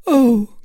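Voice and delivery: high loud oh of distress; anguished oh. A narrator's reading. Milady crying out before Felton. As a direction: let out a cry of shame.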